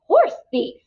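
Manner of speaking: high indignant outburst; outraged cry. Acted. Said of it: "cried Tuck".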